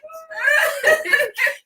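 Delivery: high cry-laugh outburst; teary laugh burst. Real, not acted. Overwhelmed by her friend's words, crying laugh. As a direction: let a sobbing laugh burst out.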